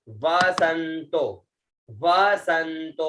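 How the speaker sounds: वसंतो drawn out slowly; slow pronunciation. Shown as a demonstration. Teacher models वसंतो slowly. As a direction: stretch वसंतो. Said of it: "उसके बाद में आता है वसंतो".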